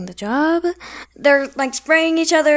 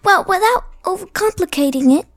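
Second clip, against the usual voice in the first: British accent attempt; 6 semitones higher, 3 dB louder than usual. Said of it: "I do only British accents".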